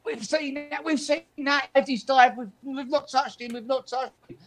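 high, pinched complaint from the players; mock angry protest. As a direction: imitate the players moaning at the ref. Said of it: "a right volley of abuse".

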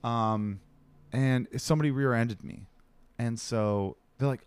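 ordinary delivery

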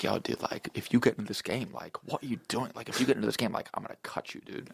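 under the breath, very soft and half-whispered; imitating rivals' hushed menace; muttered threat. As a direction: mutter it low so only he can hear. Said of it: "saying under their breath".

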